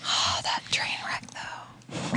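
hushed aside whisper. Real, not acted. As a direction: whisper it under your breath. Aside about the movie.

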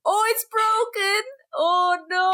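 high dismayed cry; mock dismay. Finding the shredder broken, dismayed.

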